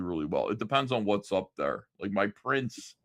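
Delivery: normal talking voice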